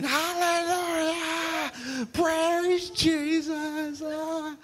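high, slow ecstatic cry; shouting praise. Retold or imitated. Healed lady jumping up, high slow hallelujah cry. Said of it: "She starts screaming".

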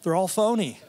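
lightly mocking teenage voice; smug teenager. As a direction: play the cynical teenager who has figured it all out. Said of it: "you're like".

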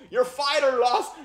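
ordinary talk

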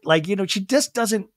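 ordinary talk, nothing marked

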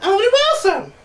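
excited announcement. Real, not acted. Announcing Owen Wilson, excited high shout. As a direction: shout the name with glee.

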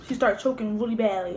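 chewing while talking; talking between bites of crab; mouth full. Real, not acted. Seen 3: bites crab meat at 0.4–1.1, then talks while chewing.

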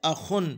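अखुन with a brief first "a"; correct short vowel. Shown as a demonstration. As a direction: go straight from a short "a" to "khun" in अखुन. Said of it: "आखुन नहीं पढ़ेंगे".

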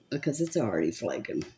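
normal talking voice

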